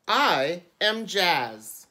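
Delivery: put-on slow title read to children; story reading. A narrator's reading. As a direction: announce the book title slowly. Reads book title to children.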